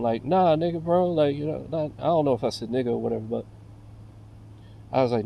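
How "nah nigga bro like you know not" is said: normal talking voice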